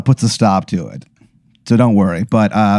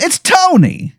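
much higher, cartoon wiseguy voice; Tony Italiano mobster; slower than usual. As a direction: say the name like a proud mob boss. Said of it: "He goes".

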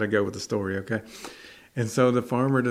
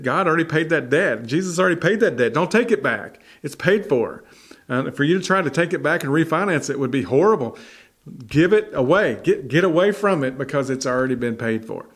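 louder, higher, driving exhortation; fervent preaching; 4 semitones higher, 4 dB louder than usual. Acted. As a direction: hammer it home to the congregation. Preaching climax.

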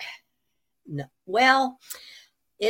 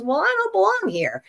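mock protest; 12 semitones higher than usual. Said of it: "And I says".

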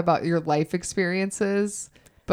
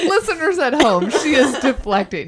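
laughing; 10 semitones higher, 6 dB louder than usual. Real, not acted. Teasing co-host, laughing.

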